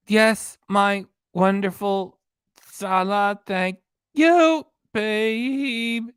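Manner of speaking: high, gushing parody of a doting husband; mock doting husband. Streamer mocking the husband from the dinner clip, high gushing parody. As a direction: ham up the sappy husband, climbing high on thank you.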